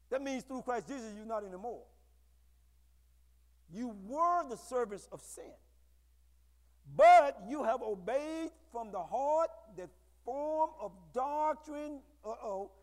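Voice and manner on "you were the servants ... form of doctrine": slow, weighty reading. Shown as a demonstration. Emphatic scripture reading.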